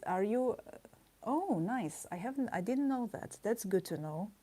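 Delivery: ordinary delivery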